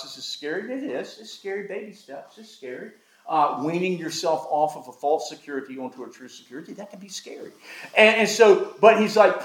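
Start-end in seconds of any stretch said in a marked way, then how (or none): 0.0-7.5 soft and gentle. Real, not acted. Pastor softly reassuring his congregation that tithing feels scary, hushed and gentle. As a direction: drop to a near whisper, reassuring.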